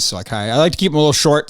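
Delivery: ordinary delivery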